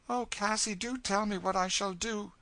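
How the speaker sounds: high, anxious pleading; pleading girl. Acted. Girl begging older companion for advice, anxious pleading.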